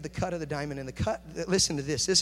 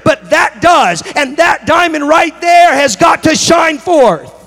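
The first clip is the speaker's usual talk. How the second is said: high, very loud sermon building to a shout; full-force preaching; 9 semitones higher, 18 dB louder than usual. Real, not acted. Preacher telling congregation about the diamond within, full-force rising shout. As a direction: drive it harder to a long, held climax.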